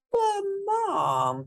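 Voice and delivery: put-on high, whiny kid protest; pleading child. Elliot begs his mom in a high whiny child voice. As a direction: plead like a boy who won't go to bed. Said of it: "pleaded Elliot".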